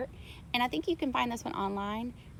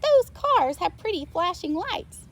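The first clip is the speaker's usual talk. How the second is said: higher, louder delighted cow; Molly admiring; 9 semitones higher, 6 dB louder than usual. A narrator's reading. Molly the cow delighted by the police lights. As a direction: marvel at the pretty lights. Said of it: "said Molly".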